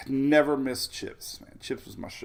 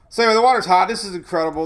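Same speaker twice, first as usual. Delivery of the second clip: loud, high burst of excitement; excited; 7 semitones higher, 12 dB louder, much faster than usual. Real, not acted. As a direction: blurt it out, thrilled. Excited about record water heat.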